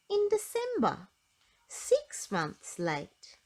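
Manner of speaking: comic punchline. A narrator's reading. Comic punchline of late dates.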